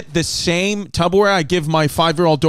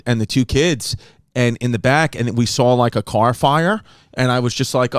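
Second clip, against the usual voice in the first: normal talking voice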